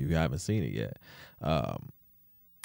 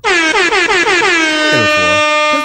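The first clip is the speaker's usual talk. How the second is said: much higher, louder, slow and stretched out; hammed-up announcement; 15 dB louder, much slower than usual. Shown as a demonstration. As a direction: belt out the rating like a big reveal. Re-take of the rating, hammed up.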